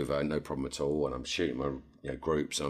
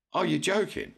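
incredulous; 14 semitones higher, 3 dB louder than usual. Retold or imitated. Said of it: "I'm like".

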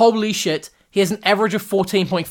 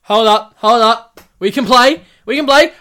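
louder, rising higher and higher; mock eager protest; 5 semitones higher, 8 dB louder than usual. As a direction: insist building up excitedly. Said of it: "England are like".

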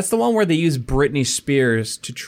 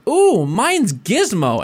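high, slow, pleased-with-himself voice; smug quote; 7 semitones higher, much slower than usual. Retold or imitated. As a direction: savor the silly password. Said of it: "And David Cross goes".

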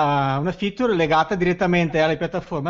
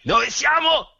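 high, loud mock German accent shout; slow hammed-up German accent; 11 semitones higher, 3 dB louder, slower than usual. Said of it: "così tedesco".

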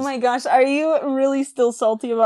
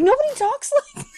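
much higher, laughing through a mock line; laughing mock quote; 4 dB louder than usual. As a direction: giggle out the imitation. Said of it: "I was like".

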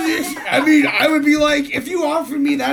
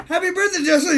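higher-pitched little mock voice; imitated birthday greeting; 6 semitones higher, faster than usual. Imitating Brucey prompted to greet Justin, high-pitched. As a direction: chirp a dutiful birthday wish. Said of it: "It's like".

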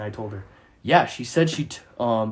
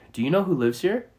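lightly voiced nosy stranger, pitched higher; 4 semitones higher than usual. Stranger asks the homeowner who lives here, lightly imitated higher voice. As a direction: imitate her question in a higher voice. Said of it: "And she's like".

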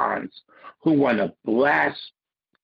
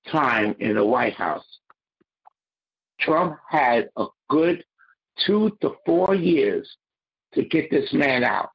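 usual speaking voice

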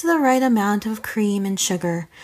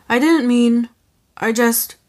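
stumbling; 3 dB louder than usual. Acted. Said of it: "he stumbled".

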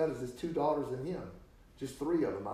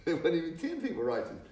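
ordinary talk, nothing marked

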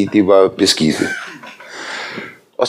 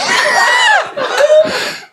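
big laugh. Real, not acted. Preacher cracking up at his own song parody.

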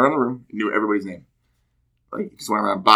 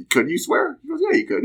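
quoting instructor. Retold or imitated.

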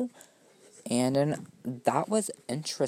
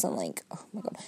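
soft whisper, dropped to a hush; whispered aside; 5 semitones lower, 9 dB quieter, faster than usual. Real, not acted. Complaining her skin looks like reptile scales, whispered. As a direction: hush it like a secret disgust.

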